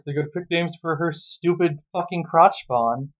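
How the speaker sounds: ordinary delivery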